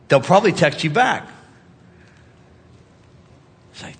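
ordinary talk

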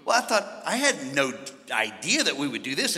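ordinary talk

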